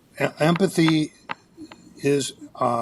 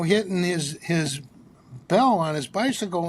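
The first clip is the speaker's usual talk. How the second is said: ordinary delivery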